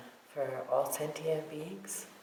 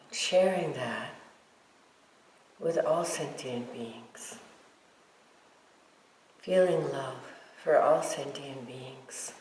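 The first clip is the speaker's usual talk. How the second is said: guided meditation. Real, not acted. Teacher guiding meditators to feel love for all beings, guided meditation. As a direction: lead them gently, leaving silence between phrases.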